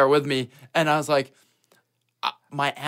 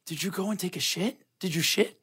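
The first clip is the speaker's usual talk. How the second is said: discreet question; 2 semitones higher, 9 dB quieter than usual. Retold or imitated. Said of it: "my brother-in-law goes".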